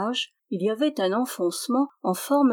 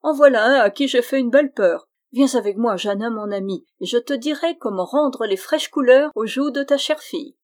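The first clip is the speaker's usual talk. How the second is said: louder, taunting cry; mocking exclamation; 4 semitones higher, 6 dB louder than usual. Dwarf teasing the hesitant squire, loud mock. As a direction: tease him with a laughing shout.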